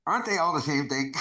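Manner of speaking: usual speaking voice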